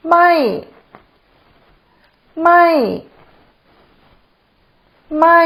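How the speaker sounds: ไม่ said alone with a falling tone. Shown as a demonstration. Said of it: "is also falling tone".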